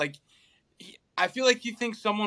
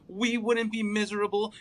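mild emphasis on "We"; stress on we. Retold or imitated. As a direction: lean on "We" in "We wouldn't be miserable". Listing who'd be happy.

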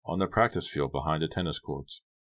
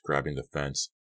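plain reading voice

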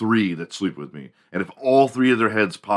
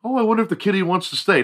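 high, fast doting voice; sweet owner voice; 11 semitones higher, faster than usual. Acted. Owner wondering about the kitty, high doting voice. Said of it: "you're never like".

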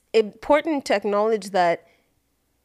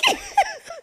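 very high-pitched squeal of laughter; 4 dB quieter than usual. Real, not acted. Speaker cracking up at their own "Deuces" joke, high laugh.

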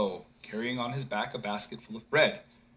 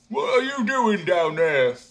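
loud, slow higher call; 7 semitones higher, 8 dB louder, slower than usual. A narrator's reading. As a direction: shout down to the ground. Simpleton calls down.

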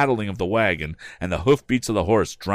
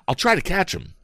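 urgent; 11 semitones higher than usual. A narrator's reading. Tom racing after wagon.

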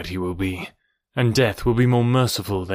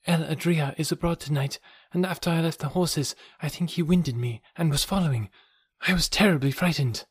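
put-on higher, gentle young woman's voice; man doing a woman's voice; 6 semitones higher, 3 dB quieter than usual. A narrator's reading. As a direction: lift into a girl's voice, softly. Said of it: "she said at last".

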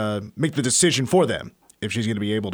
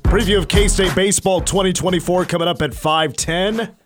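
booming prize announcer; 5 semitones higher than usual. Acted. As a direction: sell the trip like a radio promo. Promo read over music.

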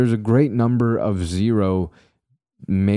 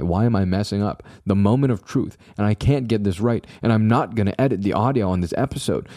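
normal talking voice

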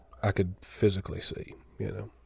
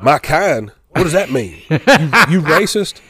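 offended outburst; 6 semitones higher, 10 dB louder, slower than usual. Retold or imitated. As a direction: bristle with offended outrage. Grandma offended by "your kind.

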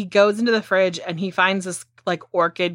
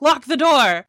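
raised, higher-pitched mock command; urgent order; 7 semitones higher, 4 dB louder than usual. George to Nancy as the creature goes out, urgent order. Acted panicked order in retold story.

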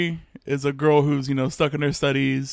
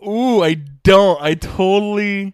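caught out; 6 semitones higher, 8 dB louder than usual. Real, not acted. Forgot the synopsis.

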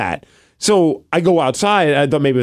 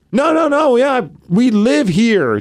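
exasperated insistence; 8 semitones higher, slower than usual. Retold or imitated. Neighbors assume house is empty.

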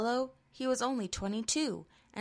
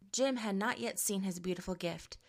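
ordinary delivery, nothing marked

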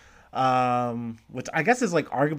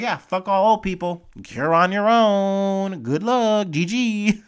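louder, higher mock sneer, slow on "You're on your own"; mocking callous voice; 5 semitones higher, 5 dB louder, slower than usual. Voicing Republicans dismissing old people, with a loud sneering mock. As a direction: jeer it like a cartoon villain brushing people off.

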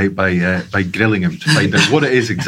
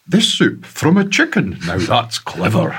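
high, hammed-up Scottish telly-advert voice; imitating a seventies Scottish soup advert; mock Scottish announcer, high then loud; 5 semitones higher, 3 dB louder than usual. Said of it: "very, very Scottish indeed".

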